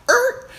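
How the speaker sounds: very high, loud, short screech; mock tire screech. Storyteller imitating a sudden stunt swerve, high loud screech. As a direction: imitate a car jerking sideways.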